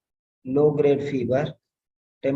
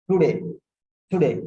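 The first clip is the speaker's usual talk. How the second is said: "today" repeated with a falling punch, louder; stressing today; 3 dB louder, faster than usual. Shown as a demonstration. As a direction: hit "today" firmly twice. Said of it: "So present tense".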